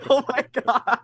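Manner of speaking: high-pitched, out of a real laughing fit. Real, not acted. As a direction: gasp it out while recovering from giggles. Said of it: "why am I laughing".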